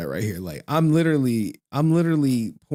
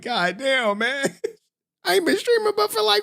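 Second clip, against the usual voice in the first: higher-pitched mock exasperation; exasperated; 11 semitones higher than usual. Streamer reacting to an early superchat, exasperated. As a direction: exclaim it in higher-pitched disbelief.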